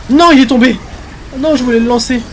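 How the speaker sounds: loud high cry of dismay; dismayed shout. Real, not acted. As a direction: yell as it slips away. Objet tombé en plein combat. (cri de dépit aigu)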